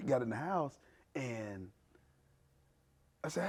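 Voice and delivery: ordinary talk, nothing marked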